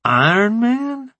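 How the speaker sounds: high, slow, incredulous echo; sceptical questioning. A narrator's reading. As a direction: repeat it as a drawn-out, disbelieving question. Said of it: "Rambo asked with a brow lift".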